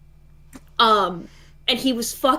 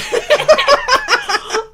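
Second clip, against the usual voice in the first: loud, high burst of laughter; real laughing fit; 14 semitones higher, 6 dB louder than usual. Real, not acted. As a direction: crack up at the memory. Laughs recalling going ballistic.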